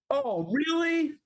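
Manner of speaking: high drawn-out surprise; surprised. Real, not acted. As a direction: draw out a shocked really. Mock surprise at Steve.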